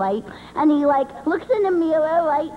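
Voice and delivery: normal talking voice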